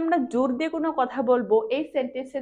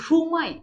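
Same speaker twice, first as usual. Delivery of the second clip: সুমাই said loud with a falling tune; stress on Suma; 3 semitones higher, 4 dB louder than usual. Shown as a demonstration. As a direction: hit সুমাই hard. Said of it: "এই সুমা শব্দের উপরে জোর দিব".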